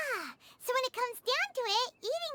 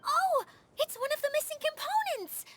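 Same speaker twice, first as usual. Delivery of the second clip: surprised; 5 semitones higher than usual. Acted. Paimon spots the found component.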